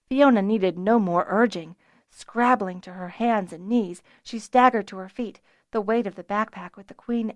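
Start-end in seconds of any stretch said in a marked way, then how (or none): none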